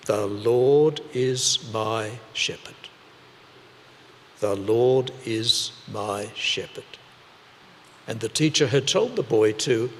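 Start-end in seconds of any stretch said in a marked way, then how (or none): 0.1-7.0 slow, deliberate recitation, word by word. Shown as a demonstration. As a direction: recite it slowly like a lesson learnt by heart. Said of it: "they'd learnt".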